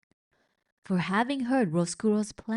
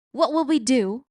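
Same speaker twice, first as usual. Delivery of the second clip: repeated wail, a bit less loud; 5 semitones higher, 7 dB louder than usual. A narrator's reading. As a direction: wail it again, higher. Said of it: "wailed Cook".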